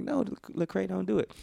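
high retold plea; high-pitched plea. Retells his plea to [name].

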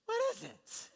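very high-pitched mock bewilderment; baffled grumbling. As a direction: squawk it as a comic complaint. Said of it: "They picked it up and said".

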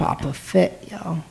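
mock British slang. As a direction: toss off "Proper fit, yo" like a playful Brit impression. Echoing the British slang joke.

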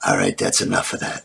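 mock Eastwood calling cut; imitating Clint Eastwood. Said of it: "he goes".

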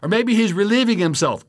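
high, loud sneering jeer at the false prophets; mocking taunt. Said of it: "he's mocking the prophets of Baal".

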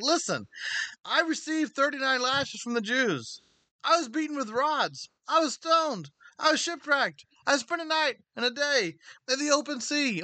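high-pitched, indignant list of hardships; acted exasperation, pitch pushed way up; impassioned rebuke. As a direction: throw each hardship back at the complainers. Acting Paul rebuking complainers with hardships.

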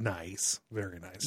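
soft, low catchphrase impression; a quiet, understated movie-character quote; Borat. Quoting Borat's catchphrase after naming him.

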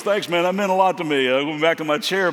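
ripped dad shouting thanks; hearty thanks. Retold or imitated. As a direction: belt out the big guy's thanks. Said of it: "he goes".

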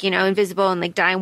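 ordinary talk

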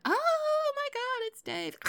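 high breathless gasp of awe; starstruck gasp. Retold or imitated. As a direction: gasp it out like meeting a star. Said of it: "be like".

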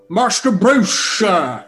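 mock old-man Alfred accent; Michael Caine butler impression. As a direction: do a posh old butler, a little hammed. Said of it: "trying to do old man Michael Caine".

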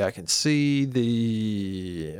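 drawn-out, low, trailing words; stalling. Real, not acted. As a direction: stretch the last word while you search. Stalling while screen loads.

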